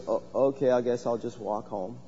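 softly imitating a timid shy man, trailing off; a hint of a meek, deflated guy giving up. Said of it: "he was so timid, so shy".

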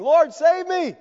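high, loud cry to the Lord; cry for help. Acted. Sinking Peter crying to the Lord, high and loud. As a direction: call out sinking. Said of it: "he cries out saying".

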